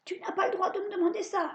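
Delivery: high witch voice, indignant. A narrator's reading. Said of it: "dit la sorcière". (voix aiguë de sorcière indignée)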